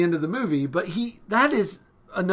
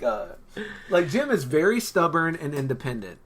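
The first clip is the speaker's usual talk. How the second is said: ordinary talk, nothing marked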